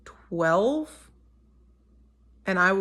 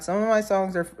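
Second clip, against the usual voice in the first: ordinary talk, nothing marked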